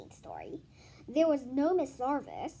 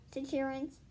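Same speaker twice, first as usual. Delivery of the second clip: ordinary delivery, nothing marked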